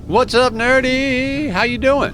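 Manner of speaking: high, loud, slow sing-song hello; excited greeting. Real, not acted. Streamer greeting a chat viewer, high and excited. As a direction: greet the viewer with a big bright welcome.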